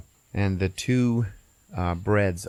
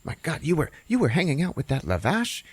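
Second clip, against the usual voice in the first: high, scandalized gossip; higher-pitched aghast busybody; Gefilte Fish; 7 semitones higher than usual. Retold or imitated. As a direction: gasp the question in mock shock. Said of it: "Gefilte Fish is".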